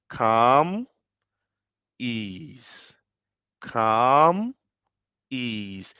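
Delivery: guided breathing. Shown as a demonstration. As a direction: breathe the words like a meditation guide. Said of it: "when you breathe in ... "Calm".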